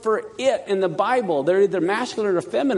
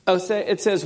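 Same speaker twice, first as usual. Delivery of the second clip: ordinary talk, nothing marked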